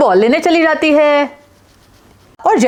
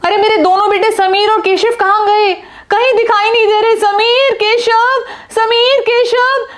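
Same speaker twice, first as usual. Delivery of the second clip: much higher, calling out her sons' names; frantic mother calling; 6 semitones higher than usual. Acted. Mother shouts for her missing sons across the park, frantic. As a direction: search the park calling for them.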